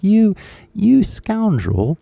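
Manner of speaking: high, drawn-out British scolding; mock British outrage. British person catching out a fake accent, mock-outraged British accent. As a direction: play an affronted Brit, pitched well up. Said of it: "a real British person would hear".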